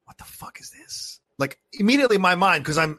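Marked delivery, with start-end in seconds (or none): 0.0-1.2 slow frightened whisper; hushed terrified whisper to himself; scared whisper. Retold or imitated. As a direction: whisper it like you're hiding. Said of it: "And I'm thinking".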